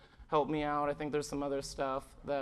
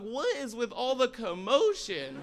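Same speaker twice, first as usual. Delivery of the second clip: slow, high voice for the dog; a touch of a baffled dog looking up; 7 semitones higher, 4 dB louder than usual. Retold or imitated. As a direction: voice the puzzled dog. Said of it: "He's like".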